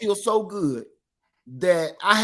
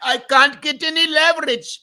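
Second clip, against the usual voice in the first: imitating an Indian accent, higher and louder; mock Indian accent, raised pitch; 8 semitones higher, 6 dB louder than usual. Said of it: "he kept saying in an Indian accent".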